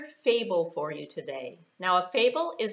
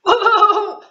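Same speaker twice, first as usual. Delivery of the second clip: loud, high burst of a laugh; startled laugh; 14 semitones higher, 20 dB louder than usual. Acted. Lion jolted awake by the tickle, loud startled laugh. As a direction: jolt awake with a yelp of laughter.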